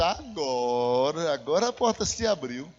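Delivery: low, stretched-out "agora"; drawn-out aha. Retold or imitated. Preacher recalling his reaction to the returned card, low and drawn out. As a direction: drag the first word out low and slow. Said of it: "Eu disse".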